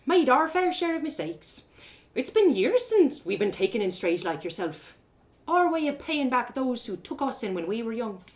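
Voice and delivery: a friendly tavern barmaid welcoming a stranger; cheerful barmaid. Acted. Rose reassures the stranger.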